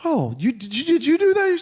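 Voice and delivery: a stunned, high squeak of surprise; amazed disbelief. Retold or imitated. Surprised dad seeing finished greenhouse.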